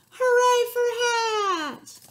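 high, loud, stretched cheer. Acted. Elephant and Zebra cheering together, long loud cheer. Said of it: "They both cheered".

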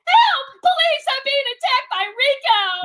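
high, hammed-up alarm; panicked cry for help. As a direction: shriek for help as if under attack.

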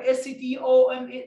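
letter names "اس تي او ام اي" chanted high; rote spelling demo. Shown as a demonstration. Teacher showing the wrong way to learn stomach, high rote spelling. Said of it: "لا، لا، لا، ها".

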